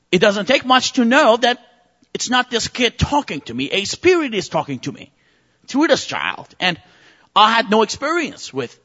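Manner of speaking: ordinary talk, nothing marked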